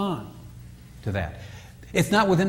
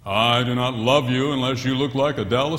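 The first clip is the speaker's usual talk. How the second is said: cold husband's message; 5 semitones lower than usual. Retold or imitated. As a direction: intone the rejection coldly. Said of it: "that message is this".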